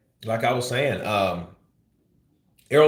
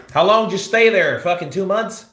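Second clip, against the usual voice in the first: incredulous jab; 8 semitones higher, 4 dB louder than usual. Real, not acted. Mocking retort to Spence's claim.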